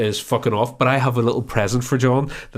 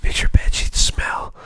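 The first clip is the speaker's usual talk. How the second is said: slow whispered advert voice; washing up advert whisper; slower than usual. Retold or imitated. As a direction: hush it like a fabric softener ad. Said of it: "You sound like every washing up advert".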